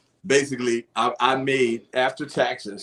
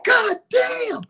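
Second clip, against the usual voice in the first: shocked caseworker; 4 dB louder than usual. Retold or imitated. Caseworker voiced in story.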